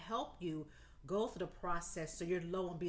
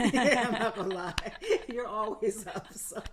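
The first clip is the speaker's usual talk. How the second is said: louder, talking through real laughter; laughing teasingly; 7 dB louder than usual. Real, not acted. Teasing Ashley about always being up, laughing. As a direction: tease her through the giggles.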